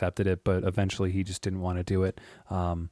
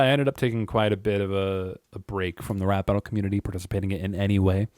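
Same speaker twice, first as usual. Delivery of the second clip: ordinary talk, nothing marked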